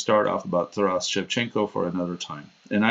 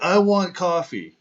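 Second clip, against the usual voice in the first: mock demanding; 9 semitones higher, slower than usual. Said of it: "he's like".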